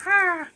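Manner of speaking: short, loud, high play shriek; mock bat scream. Acting out the bat's expression, with a mock high scream. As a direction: imitate the terrified bat with a shriek. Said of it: "his expression".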